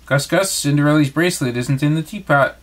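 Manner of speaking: ordinary delivery, nothing marked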